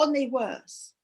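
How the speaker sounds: plain reading voice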